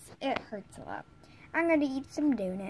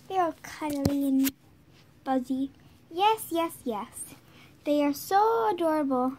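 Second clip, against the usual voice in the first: ordinary delivery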